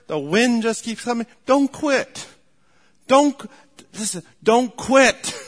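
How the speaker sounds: loud, high-pitched preacher's exhortation; urgent pulpit rallying cry; full-force preaching. Real, not acted.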